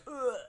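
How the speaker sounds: high strained groan; pained grunt. Retold or imitated. Bart collapsing after the blast, pained grunt. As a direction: let it out as he collapses. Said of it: "Bart just goes".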